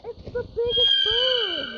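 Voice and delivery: loud excited exclaim; real delight at a huge bird. Real, not acted. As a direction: blurt it out in awe. Kid sees huge bird in game.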